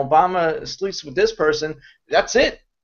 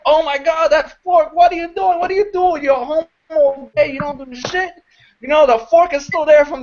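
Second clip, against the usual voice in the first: 1 mock nagging wife; 11 semitones higher, 3 dB louder than usual. Wife scolding over fork on counter.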